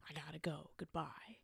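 soft whispered goodbye. Retold or imitated. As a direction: breathe it out quietly. Voicing the breakup call.

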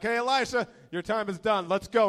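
high, loud, cheerful summons; booming pickup call; loud call from heaven. Retold or imitated. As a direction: call him up like an excited friend. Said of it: "they're like".

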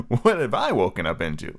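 usual speaking voice

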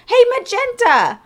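high, loud call across a store. Retold or imitated. Stranger calls out her stage name in a high loud call. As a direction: shout the name to catch her attention.

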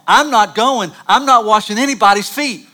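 put-on high, loud teenage protest at mom; furious kid digging in his heels; ticked-off refusal. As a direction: refuse it hot and indignant. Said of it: "I was ticked".

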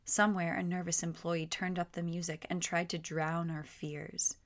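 plain reading voice

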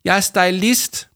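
high, loud, drawn-out whine; sniffling protest. A narrator's reading. Crying Jame insists on her job, tearful whine. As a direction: wail it slowly, wounded. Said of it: "snøftede Jama".

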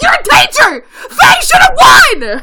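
yelling at the sister, outraged. Retold or imitated. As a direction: shout her down.

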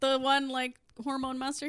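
ordinary talk

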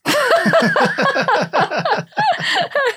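laughing out loud at the joke; a big high burst of laughter. Real, not acted. Laughing at the German lady's fart joke, high burst of laughter.

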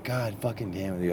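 soft, nervous cursing; understated nervous swearing; retold nervousness. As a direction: curse under your breath, on edge. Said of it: "I was very nervous".

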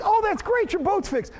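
high, sing-song fake delight; mock cheer. Mocking the cheerful reaction he didn't feel, high and sarcastic. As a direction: sell the fake happiness sarcastically. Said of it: "you think".

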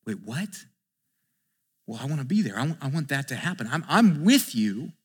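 quietly acted confused disciple; bewildered protest. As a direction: say it small and soft, as if caught off guard. Acting Peter caught up, bewildered.

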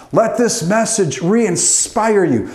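ordinary talk

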